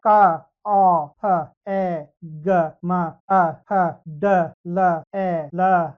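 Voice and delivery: each letter sound called out loud and low, one by one; sound drill. Shown as a demonstration. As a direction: model the reading for the pupils. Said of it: "कैसे पढ़ना है". (अक्षर की ध्वनि ज़ोर से, एक-एक करके)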